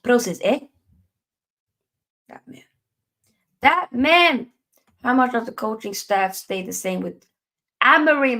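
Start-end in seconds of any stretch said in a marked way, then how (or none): 2.3-2.6 "That man." under the breath, nearly silent; muttered. Acted. Streamer mutters about Ten Hag, under the breath. 3.6-4.5 "That man." loud and high, falling hard; exasperated outburst. Acted. Streamer erupts about Ten Hag, loud exasperation.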